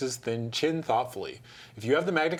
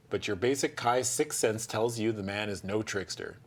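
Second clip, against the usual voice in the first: ordinary delivery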